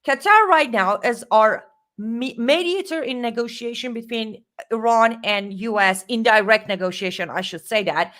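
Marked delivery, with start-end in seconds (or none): none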